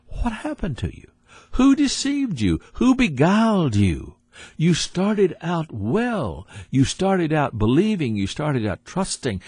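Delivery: high-pitched, incredulous reproach; acted-out apostle's rebuke, high and pressing; reproachful questioning. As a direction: confront them as Paul, voice pitched well up. Paul rebukes the Galatians, acted out.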